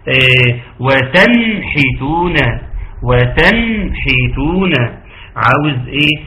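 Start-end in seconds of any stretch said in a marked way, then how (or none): 0.8-4.7 وتنحتون slowly drawn out with the noon sounded clearly. Shown as a demonstration. Teacher demonstrates a strong izhar to the students. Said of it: "أنا عاوز إظهار بقوة".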